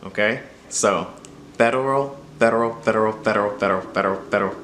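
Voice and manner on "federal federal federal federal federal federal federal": federal repeated faster so the d slides toward an r; speeding up into rolled r. Shown as a demonstration. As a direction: federal drilled quicker each time, d blurring into a flap. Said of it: "get from federal to ferrocarril".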